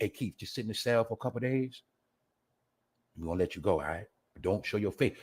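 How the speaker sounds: low, softened voice of a secret handler; acted confidential aside, low and soft; hushed mock advice. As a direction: pretend to quietly let someone off. Acts out authorities quietly advising Keith.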